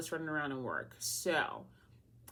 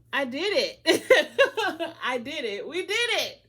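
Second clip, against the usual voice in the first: high, loud triumphant cheer; Dorothy Explorer voice; 8 semitones higher, 11 dB louder than usual. Pretend. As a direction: cheer like a kids' show explorer. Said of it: "and my Dorothy Explorer voice".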